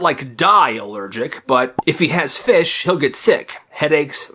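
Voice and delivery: usual speaking voice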